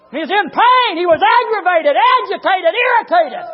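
high shouted pulpit list; full-force preaching. Real, not acted. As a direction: hammer each word out loud and high.

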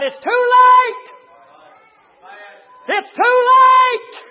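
very high, drawn-out scream; dying girl's shout, acted full out; despairing cry. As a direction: wail it out at full volume. Trapped girl cries back to father.

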